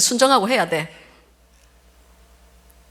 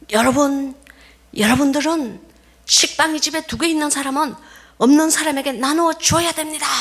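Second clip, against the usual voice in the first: high, pressing preacher voice; fervent preaching; 7 semitones higher than usual. Retold or imitated. Missionary preaching to villagers about sharing bread, high fervent preaching. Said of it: "사람들에게 막 이렇게 프리칭을 하는 겁니다".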